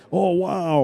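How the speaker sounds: high mock wow; imitating his own screaming. Explaining his habit to viewers, high mock wow. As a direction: retell his excited outburst. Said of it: "I'm, like, screaming".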